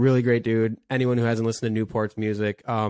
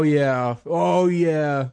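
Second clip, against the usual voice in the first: ordinary delivery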